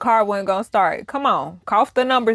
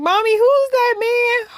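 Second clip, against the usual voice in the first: mock scared child; 13 semitones higher than usual. Mimicking scared child in car.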